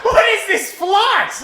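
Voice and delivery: high, drawn-out shout of disbelief; gobsmacked. Retold or imitated. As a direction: shriek the question in comic astonishment.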